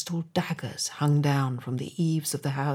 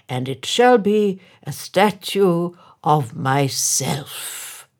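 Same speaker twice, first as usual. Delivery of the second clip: slow, louder, self-important; pompous mayor; 3 semitones higher, 8 dB louder, slower than usual. A narrator's reading. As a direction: savour each word of vanity. Mayor claims the statue.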